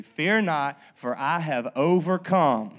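loud, slow proclamation; preaching scripture. Acted. Pastor quotes Jesus to his congregation, loud slow proclamation. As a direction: declare it with full conviction.

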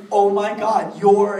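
very loud, high outburst; starstruck fangirl shock. Retold or imitated. Fan realising who sits next to her, starstruck shock. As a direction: blurt it out in loud, overwhelmed awe.

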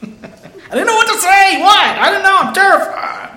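high, loud, laughing panicked squeak; panicked squeal. Retold or imitated. Peter panicking on the mountain, high mock-terrified squeal, laughing.

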